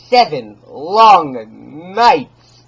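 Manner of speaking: very slow, loud and stretched; drawn-out loud emphasis; dragged out. Acted. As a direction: stretch the words out painfully. Dwelling on long obsession.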